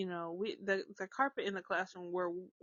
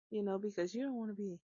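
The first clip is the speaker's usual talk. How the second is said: ordinary talk, nothing marked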